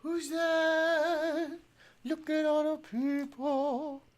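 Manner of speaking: high, slow cooing to the dog; high sing-song pet talk. Real, not acted. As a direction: coo at the puppy. Talking to dog.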